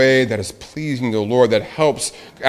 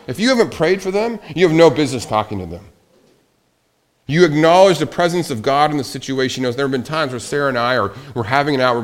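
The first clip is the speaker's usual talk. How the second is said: usual speaking voice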